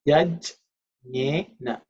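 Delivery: "Yajnena" broken up as yaj, nye, na; syllable-by-syllable model. Shown as a demonstration. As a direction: pause between the syllables of "Yajnena". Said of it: "is the right pronunciation".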